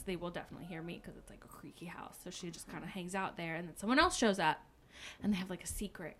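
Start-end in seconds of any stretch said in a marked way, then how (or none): 0.0-2.2 fast hushed whisper. Retold or imitated. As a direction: whisper it so nobody hears. Helen quietly thinking in hiding.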